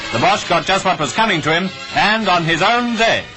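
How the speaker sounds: usual speaking voice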